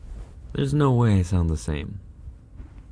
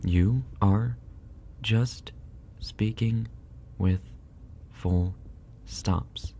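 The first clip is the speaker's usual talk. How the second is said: word by word; 3 semitones higher, 4 dB quieter, much slower than usual. Shown as a demonstration. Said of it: "there is a difference".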